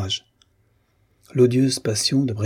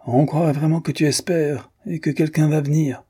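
higher-pitched scornful taunt; mock her hope meanly; jeering, voice lifted; nasty sneer; 6 semitones higher than usual. A narrator's reading. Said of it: "avec un rire mauvais".

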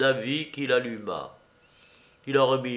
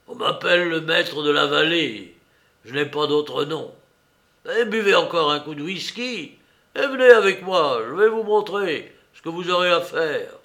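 loud, raised voice of a commanding master; lightly hoarse master giving orders, louder; muffled, hoarse master; 4 semitones higher, 6 dB louder than usual. A narrator's reading. Master ordering his new worker along, lightly hoarse and loud. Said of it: "la même voix à la fois assourdie et rauque".

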